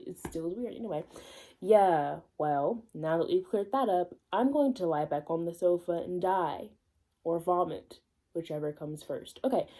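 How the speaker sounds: ordinary talk, nothing marked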